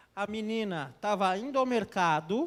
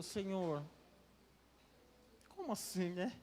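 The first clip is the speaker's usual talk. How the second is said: embarrassed quiet; 9 dB quieter than usual. Retold or imitated. Embarrassed woman whispering to God.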